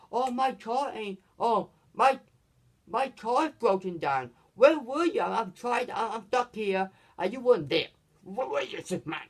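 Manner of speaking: high-pitched, agitated complaint; mock scolding. Lois complaining to Superman about her car, mock scolding. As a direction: imitate an upset woman demanding answers.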